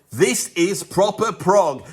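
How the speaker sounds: loud, high, slow proclamation; emphatic exclamation. Acted. Host reading out his written notes, emphatic exclamation. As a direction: read it out like it ends in exclamation marks. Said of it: "Exclamation mark, exclamation mark".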